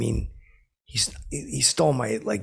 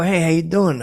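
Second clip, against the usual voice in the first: loud, higher, showy hello; hearty mock greeting; 7 semitones higher, 6 dB louder than usual. [name] greets O'Cannon, loud and eager. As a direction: greet him big and eager.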